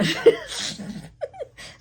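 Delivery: airy high-pitched laugh; a silent, wheezing laugh; high breathless laugh. Real, not acted. Laughing at husband's dry reply, high airy laugh.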